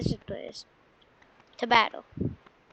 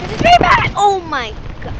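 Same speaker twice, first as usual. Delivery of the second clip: much higher and louder alarmed cry; panicked shout; 8 semitones higher, 13 dB louder than usual. Real, not acted. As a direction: shout in real alarm under fire. Under attack, panicking.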